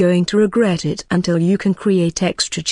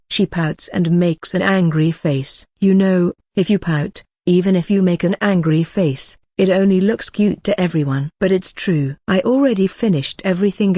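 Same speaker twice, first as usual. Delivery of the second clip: usual speaking voice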